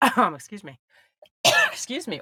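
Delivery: coughing through the words; sputtering apology between coughs; choking on a drink. Real, not acted. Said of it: "my air went down the wrong way".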